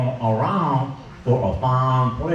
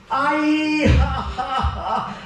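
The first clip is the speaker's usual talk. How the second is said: much higher, delighted cackle of Thibodeau; gleeful laugh; 11 semitones higher, slower than usual. Acted. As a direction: laugh it up like he loves the cold. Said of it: "laugh on and carrying it on".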